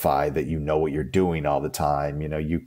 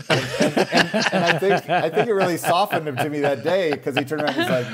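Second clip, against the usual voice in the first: laughing; 10 semitones higher than usual. Real, not acted. Laughing while telling story.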